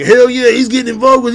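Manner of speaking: high, loud mocking fan voice; mock supporter. Mocking Trump supporters cheering him on. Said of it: "they're like".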